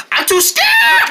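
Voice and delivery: sudden, extremely high panicked scream; scared shriek. Acted. Scared poodle refusing to calm down, shrieking. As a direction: shriek the last word in cartoon terror. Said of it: "I'm too scared to calm down".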